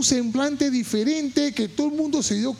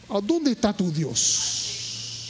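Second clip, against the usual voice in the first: slow, searching question; 2 semitones lower, much slower than usual. Real, not acted.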